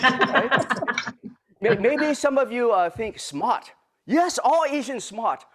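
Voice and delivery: very bad put-on Japanese accent; hammed-up Japanese accent with dropped grammar; Mister Miyagi. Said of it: "my very bad Mister Miyagi accent".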